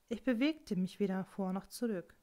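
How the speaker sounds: usual speaking voice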